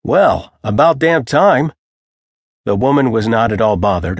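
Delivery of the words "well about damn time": impatient grumble, higher and drawn out; mildly angry, slow, emphatic complaint; half angry. A narrator's reading. Man kept waiting greets the woman from the office, half angrily. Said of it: "He looked at the woman half angrily".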